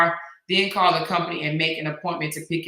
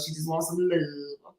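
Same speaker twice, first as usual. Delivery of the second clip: soft country twang; 3 semitones lower, 5 dB quieter than usual. Retold or imitated. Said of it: "She calls it love".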